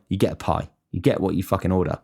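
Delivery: usual speaking voice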